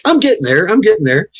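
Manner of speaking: high cheerful reply; good-natured Brit. Retold or imitated. Brit answering the American, high cheerful. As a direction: answer with a chuckle. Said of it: "he's like".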